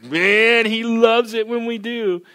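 high, loud, drawn-out cry; fervent preacher's holler; exuberant exclamation. Real, not acted. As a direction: belt it out with joyful conviction. Preacher exclaims joyfully about singing.

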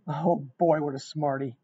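usual speaking voice

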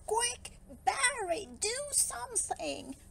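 high, panicky fish calling for help; urgent cry. A narrator's reading. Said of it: "cried the fish".

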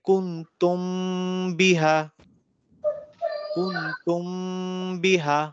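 কুনতুম বিহা with the মিম humming in the nose before বিহা; ikhfa with ghunna. Shown as a demonstration. Teacher repeating the example for students, nasal hum. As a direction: hum the মিম, then land cleanly on বিহা. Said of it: "একটা গুন্না করব যেহেতু বা আছে".

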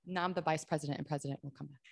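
hushed fast aside; golf commentator. Acted. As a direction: murmur it quickly like a golf announcer. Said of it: "Was that hushed tones?".

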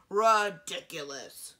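loud, slow, booming dismissal; vigorous. A narrator's reading. Old dragon dismissing the wizard idea, loud and slow. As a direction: boom it out slowly, scornfully. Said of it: "Gorham replied with vigor".

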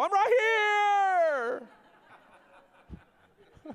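high, loud, laughing call; mock shout. Pastor acting out a man shouting to God outside, laughing. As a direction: yell it out the door like a madman. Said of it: "your neighbors will go, "That guy's crazy".

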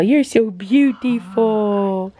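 slowly gushing over the dog; slow drawn-out pet talk. Real, not acted. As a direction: stretch it out slowly, doting on the dog. Praising the dog again.

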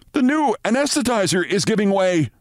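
high, urgent alarm call; shouting intern. A narrator's reading. Intern shouting to the surgeons in alarm. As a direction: cry out that the machine is failing. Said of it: "shouted an intern".

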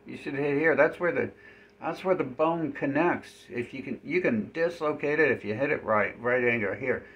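high, loud, odd character voice; goofy NPC voice. Retold or imitated. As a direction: ham up a dim game character. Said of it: "He's like".

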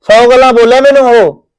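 retold heated reply to the uncle; retold angry retort. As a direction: replay the fight with heat. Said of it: "ਮੈਂ ਕਿਹਾ".

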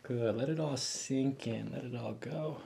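hushed, soothing coaching voice; soft breathing guidance. Real, not acted. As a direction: talk her gently through breathing out. Chiropractor guiding breath softly.

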